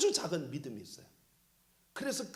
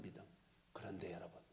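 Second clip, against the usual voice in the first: hushed whisper; 12 semitones lower, 18 dB quieter, faster than usual. Real, not acted. 성도들에게 놀라운 사실을 전하며, whisper. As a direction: drop to a whisper to draw them in. Sermon turn to wonder.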